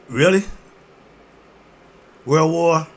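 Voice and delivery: Really thrown out loud with a rise; loud incredulous Really. Real, not acted. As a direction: challenge them with a loud Really. Rhetorical doubt, asked twice.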